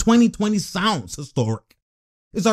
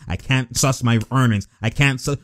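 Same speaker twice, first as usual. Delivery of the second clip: imitating a player; 6 semitones lower than usual. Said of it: "doing that Ed Davis type shit".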